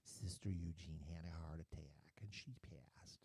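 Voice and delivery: whispered, slow bad news; hushed news. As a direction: breathe it out like sad news. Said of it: "my mother calls and says".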